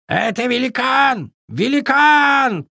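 high, panicked raven shouting; frightened raven cry. A narrator's reading. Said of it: "прокричал перепуганный ворон".

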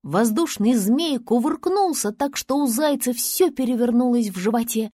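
ordinary delivery, nothing marked

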